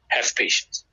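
hushed whisper of advice. Real, not acted. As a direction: whisper it gently. Sermon advice, hushed for emphasis.

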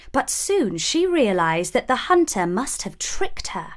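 ordinary delivery

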